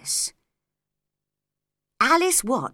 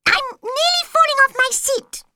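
very high, louder squeaking; squeaky Dormouse; 8 dB louder than usual. Acted. Dormouse to Alice about his seat, very high squeak. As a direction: squeal it like a tiny cross animal.

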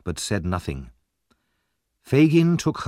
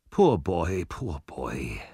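pitying; 5 semitones lower, 4 dB quieter, much slower than usual. A narrator's reading. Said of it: "said Mister Brownlow, bending over him".

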